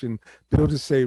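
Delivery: normal talking voice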